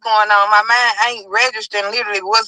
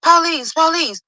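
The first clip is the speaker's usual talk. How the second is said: officers calling; 6 semitones higher than usual. Retold or imitated. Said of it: "I'm hearing".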